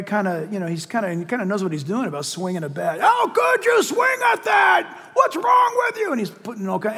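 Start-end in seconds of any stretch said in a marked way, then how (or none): 3.0-6.1 very high-pitched heckler's shout, drawn out; mock yelling. Pastor imitating a drunk fan berating the batter, high yelling. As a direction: shout like an outraged fan, mimicked in a strained high voice. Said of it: "this guy's yelling, he's yelling".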